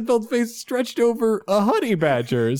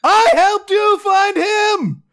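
hammed-up anguished shout, stretched and loud; betrayed shouting; 10 semitones higher, 4 dB louder than usual. Character accuses his former partner of betrayal, shouting.

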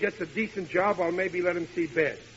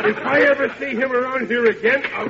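higher, louder blustering rage; angry threat; 7 semitones higher, 5 dB louder than usual. Acted. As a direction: explode at the thought of him. Father fumes about daughter's boyfriend.

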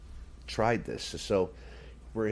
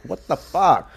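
mock outburst; 7 semitones higher, 10 dB louder, slower than usual. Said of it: "he's like".

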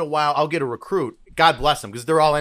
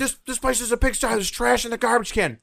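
higher put-on scolding voice; mock drill sergeant rant; 8 semitones higher than usual. Drill sergeant scolding recruits about trash. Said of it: "he's like".

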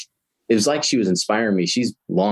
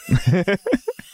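burst of laughter; 11 semitones higher than usual. Real, not acted. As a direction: laugh out at the lucky surprise. Chip reveals himself at pump.

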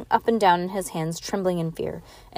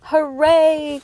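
high, loud, drawn-out hooray; raised, sustained whoop; called-out cheer; 8 semitones higher, 6 dB louder than usual. A narrator's reading. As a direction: cheer from the back of a crowd. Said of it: "called members of V F D from the back".

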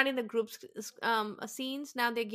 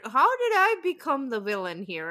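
higher, louder imitated protest; mock indignant protest; 4 semitones higher, 7 dB louder than usual. Recapping a cast member's villain complaint, mock indignant. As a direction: play up the wounded disbelief, raising pitch and volume.

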